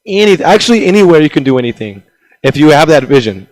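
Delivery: ordinary talk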